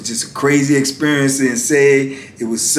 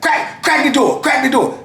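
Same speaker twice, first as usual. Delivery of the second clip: retold order, pitched way up; officer calling orders; 12 semitones higher than usual. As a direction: call down the tier in an officer's voice. Officers call to open the door.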